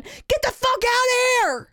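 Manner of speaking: high, loud, slow yell; retold yell at kids. Mom yelling at her children at checkout. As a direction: shout at the kids off the scale. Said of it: "I'm screaming at my children".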